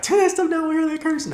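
high mock scold of a mother; mom scolding. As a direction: imitate mom yelling to turn it down, way up high. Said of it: "she was like".

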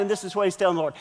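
ordinary talk, nothing marked

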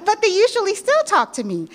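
high laughing voice. Real, not acted.

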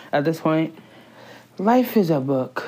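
usual speaking voice

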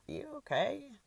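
high, rising check-in; concerned question. A narrator's reading.

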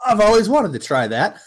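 high, loud, excited put-on voice; eager stranger voice. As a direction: chirp it like a thrilled stranger. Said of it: "they're like".

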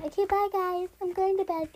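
put-on affected, high girly voice; pick-me girl. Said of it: "And then the real voice is like".

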